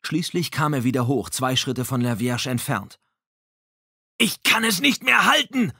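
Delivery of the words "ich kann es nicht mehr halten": high, loud desperate yell; screaming. A narrator's reading. Lavierge cries out to Dominique that he is losing the rope, loud desperate scream. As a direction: shout as the rope slips away. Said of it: "schrie Lavierge".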